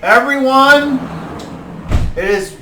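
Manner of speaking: high, loud proclamation to the hall; lord's announcement. Acted. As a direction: address the whole room grandly. Lord addresses the hall.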